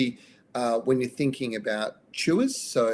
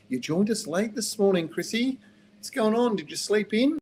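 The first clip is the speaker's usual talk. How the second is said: teasing; 9 semitones higher than usual. Real, not acted. Host teasing a late listener.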